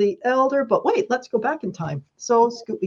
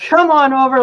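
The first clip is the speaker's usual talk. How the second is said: eager invitation; 5 semitones higher, 10 dB louder than usual. Retold or imitated. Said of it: "and Paul says".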